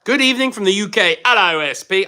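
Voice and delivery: badly faked British greeting; bad British accent. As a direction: put on a hammy British accent. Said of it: "I'm bad at the accent".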